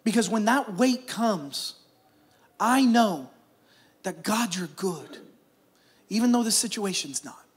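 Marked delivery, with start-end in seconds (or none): 4.2-7.4 soft, intimate prayer; quietly, almost to himself; hushed prayer. Real, not acted. Pastor softly repeating his prayer to the congregation.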